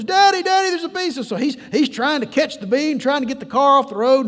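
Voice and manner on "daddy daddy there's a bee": high-pitched imitation of a panicking child; going to pieces. Girl allergic to bees calls her daddy, panicked. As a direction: call out high, as a scared little girl. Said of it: "she's just going to pieces".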